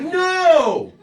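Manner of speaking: high, very loud shriek of "No!"; frantic scream. Retold or imitated. Ex-wife shrieking at husband about to kill spider, loud high "No!". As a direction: shriek to stop him.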